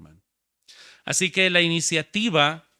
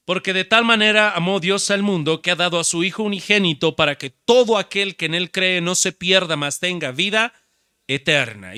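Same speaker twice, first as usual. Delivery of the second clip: louder, higher preaching read of the verse; preaching scripture; 4 semitones higher, 9 dB louder than usual. Acted. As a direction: proclaim the verse to the congregation. Reads scripture forcefully. (proclamando el versículo con fuerza)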